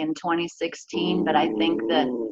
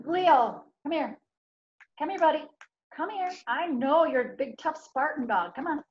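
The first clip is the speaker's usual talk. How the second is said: coaxing the dog; 6 semitones higher, 6 dB quieter than usual. Real, not acted.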